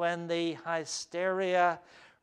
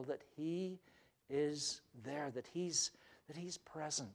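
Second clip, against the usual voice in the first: quiet, intimate; 14 dB quieter than usual. Real, not acted. Quiet emphasis on God's presence.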